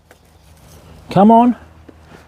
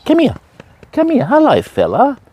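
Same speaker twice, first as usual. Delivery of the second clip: higher coaxing call to a dog; dog talk; 4 semitones higher than usual. Real, not acted. Fisherman calling the farm dog to him, higher coaxing voice. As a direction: call the dog in a lifted sing-song.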